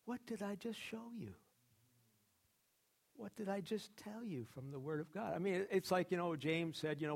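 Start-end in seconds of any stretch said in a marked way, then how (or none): 0.0-5.3 higher, softer, patient correction; a hint of a calm teacher reining his people in; acting out Jesus reminding believers what he showed them; gentle rebuke. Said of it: "Jesus saying".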